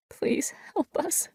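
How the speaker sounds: broken, whispered plea; voice breaking. A narrator's reading. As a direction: whisper it through breaking tears. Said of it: "her voice breaking as she said".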